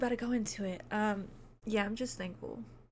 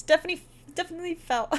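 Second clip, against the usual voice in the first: higher, louder, emphatic agreement; excited; 8 semitones higher, 6 dB louder, much faster than usual. Real, not acted. Streamer eagerly agrees that the films must be felt.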